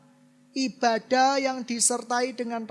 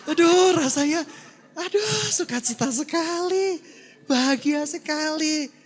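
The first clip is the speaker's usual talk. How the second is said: higher, louder, bursting with joy; overjoyed; 6 semitones higher, 5 dB louder than usual. Retold or imitated. Preacher recalling his joy to the church, ecstatic and higher. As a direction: gush like you can't contain the happiness.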